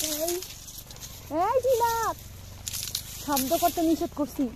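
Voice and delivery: high sharp scolding. Real, not acted. Scolding a noisy child.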